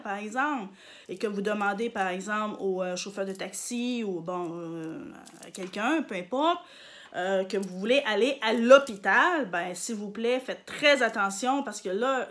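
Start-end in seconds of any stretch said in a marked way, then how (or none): none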